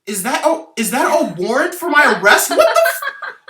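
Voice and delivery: high-pitched, loud, laughing shock; shocked laughing disbelief. Acted. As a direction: shriek it through laughter. Finds a warrant for his arrest.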